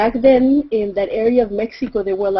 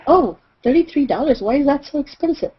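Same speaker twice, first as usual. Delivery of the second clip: higher-pitched mock protest; haggling tourist; 7 semitones higher than usual. Lady tourist haggling with the seller, higher surprised protest. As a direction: play a customer balking at the price.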